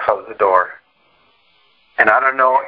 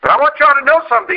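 excited dad; 4 dB louder, faster than usual. Retold or imitated. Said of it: "he said".

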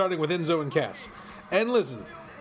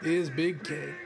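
very slow, softer promo voice; Enzo impression; 4 dB quieter, much slower than usual. Imitating Enzo introducing Big Cass. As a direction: stretch each word like a hype man. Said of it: "I'm about to do his whole shtick".

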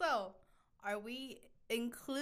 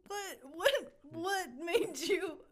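ordinary talk, nothing marked

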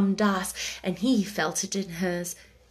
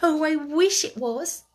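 higher, eager and hopeful; pleased excitement; 6 semitones higher than usual. Acted. Sarah hoping to Becky it is the monkey, excited. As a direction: let the hope rise into the wish. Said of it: "in a pleased excitement".

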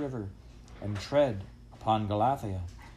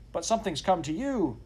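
high-voiced cheeky dog; saucy reply; 8 semitones higher than usual. A narrator's reading. Dog talking back to master, saucily. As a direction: answer back saucily, pitched up. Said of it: "answered him saucily".

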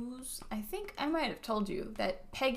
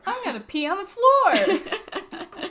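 high, slow, laughing threat; laughing mock rant; 10 semitones higher, 9 dB louder, slower than usual. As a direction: laugh while ranting. Joining the impression.

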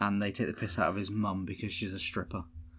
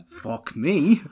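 a bit higher, louder curse; mock principal curse; 5 semitones higher, 8 dB louder than usual. Imagining a principal swearing at a pupil, mock curse. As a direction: toss it back like a fed-up head. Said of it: "Can you imagine if you'd be like".

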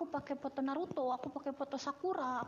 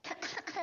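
sudden dry cough; coughing fit; 5 semitones higher than usual. Real, not acted. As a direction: cough once, hard. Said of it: "Sorry, batuk".

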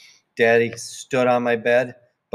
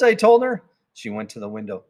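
plain reading voice

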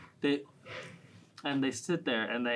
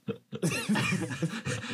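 laugh; 4 semitones higher than usual. Real, not acted. Laughing at own crude joke.

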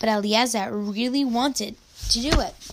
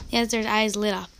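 usual speaking voice

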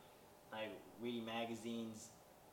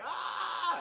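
sudden high scream, much louder; mock scream of fright; 9 dB louder than usual. Kevin sees the scary old man, high mock scream. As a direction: shriek like a startled kid.

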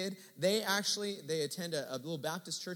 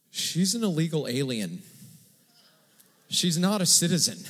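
retold blurt; 8 dB louder than usual. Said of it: "And he goes".

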